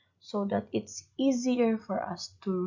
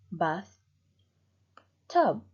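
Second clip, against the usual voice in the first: "bath, tub" as two beats with a long pause between; split into syllables; 2 semitones lower than usual. Shown as a demonstration. Teacher shows how bathtub is cut, "bath, tub" in two separate parts. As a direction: break "bath, tub" apart, each part on its own. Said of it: "you cut them two times, two parts".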